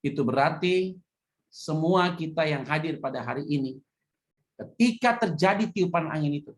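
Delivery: ordinary talk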